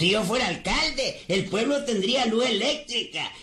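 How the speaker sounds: rising campaign fervor. Acted. Cosme dreams aloud of being mayor.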